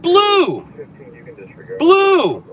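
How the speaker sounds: high, loud put-on childish yell; mock shout. Streamer mocks chat for stating the obvious, loud mock yell. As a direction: bellow it like an eager kid with the answer.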